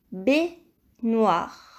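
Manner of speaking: baignoire. broken slowly into its syllables; dictation-style baignoire., a gap between syllables. Shown as a demonstration. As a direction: say "baignoire." in separate, measured syllables. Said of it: "où nous entendons le son".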